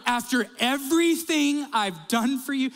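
high, loud-ish wounded outburst; exasperated parent. Retold or imitated. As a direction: act out a fed-up parent's guilt trip. Fed-up parent to ungrateful people.

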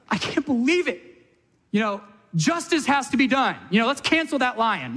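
high, indignant mock protest; mock outrage. Safari tourist protesting the lion kill, mock outrage. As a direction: lampoon a tourist demanding justice. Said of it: "nobody goes".

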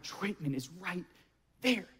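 hushed retold awe, very soft; starstruck whisper. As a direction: act out whispering about a nearby idol. Retold starstruck moment in restaurant.

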